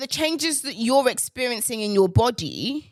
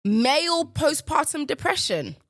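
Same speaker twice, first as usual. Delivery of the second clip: higher, disbelieving echo; scornful disbelief, pitched up; incredulous repeat; 6 semitones higher than usual. Real, not acted. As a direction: repeat it high, as if absurd.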